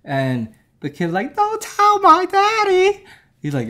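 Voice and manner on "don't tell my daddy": squeaky, slow mock child plea; little kid voice. Grandson begs granddad not to tell, squeaky kid voice. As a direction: stretch it out high and loud like a small child.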